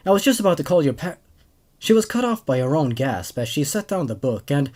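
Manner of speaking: steady, even storytelling read; story narration. A narrator's reading. Host reading a creepypasta to his viewers, calm narration. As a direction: carry the tale along calmly.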